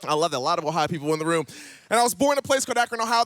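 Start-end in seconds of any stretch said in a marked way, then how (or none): 1.9-3.2 rushed, louder restart of the line; fast repeat. Shown as a demonstration. Preacher restarting after Ohio cheers, fast and brisk. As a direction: rattle it off quickly to get back on track.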